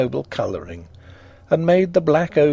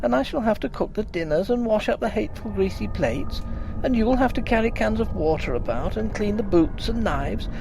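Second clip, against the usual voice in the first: higher, fretful wife's voice; upset young wife; 9 semitones higher than usual. A narrator's reading. Wife telling her husband the maid is leaving, upset. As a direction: voice her tearful complaint.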